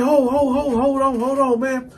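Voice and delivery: high, hurried repeated plea; flustered pleading. Retold or imitated. Caught bunkie asking for a moment, flustered. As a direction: stall him, voice pitched high.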